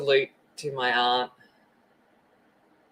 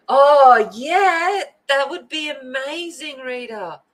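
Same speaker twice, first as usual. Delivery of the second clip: excited; 10 semitones higher, 8 dB louder, slower than usual. Real, not acted. Excited reply to Rita.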